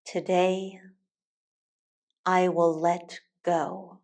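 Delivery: normal talking voice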